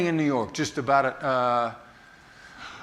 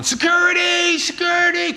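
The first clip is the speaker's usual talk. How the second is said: much higher, louder cry for security; mock shout; 6 dB louder than usual. An angry Welch calling for his guards, high mock shout. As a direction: call for the guards at the top of your voice. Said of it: "he's gonna start going".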